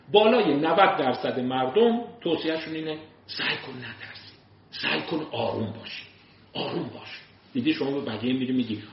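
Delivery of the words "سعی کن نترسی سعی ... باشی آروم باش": soft calming advice. Retold or imitated.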